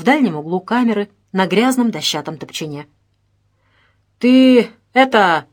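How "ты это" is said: slow, loud, discontented drawl; disgruntled grumble. A narrator's reading. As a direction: drag the words out, displeased. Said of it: "недовольно пробурчал сокамерник милиционеру". (недовольно, протяжно)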